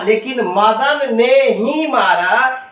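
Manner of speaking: slow, ही pressed with a rising lift; stress on ही. Shown as a demonstration. Teacher showing the class that ही gives emphasis, slow stress on ही. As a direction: lean hard on ही in Madan ने ही मारा. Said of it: "बल देकर कह रहा है".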